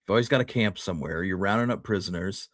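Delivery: ordinary talk, nothing marked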